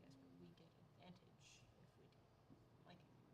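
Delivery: hushed unvoiced whisper. Real, not acted. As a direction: whisper it under your breath.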